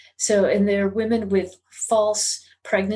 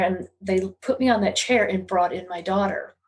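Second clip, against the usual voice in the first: ordinary delivery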